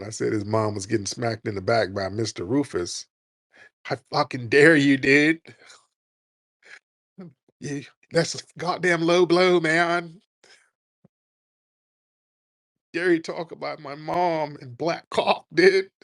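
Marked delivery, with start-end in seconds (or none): 3.9-15.9 mock outrage on the verge of tears, higher; whiny near-crying rant at the host. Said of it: "He's about to cry almost".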